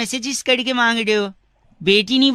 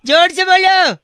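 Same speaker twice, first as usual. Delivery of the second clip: higher, louder chant-like call; 7 semitones higher, 5 dB louder than usual. Acted. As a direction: yell it like a temple chant. Prank caller shouting the chant. (ज़ोर से जयकारे जैसा पुकारना)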